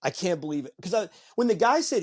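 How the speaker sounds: normal talking voice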